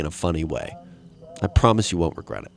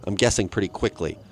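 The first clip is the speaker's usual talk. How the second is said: normal talking voice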